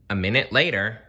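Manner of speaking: plain reading voice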